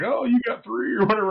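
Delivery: high, loud mock voice; imitated onlookers. Onlookers guessing at his award calls, imitated in a high voice.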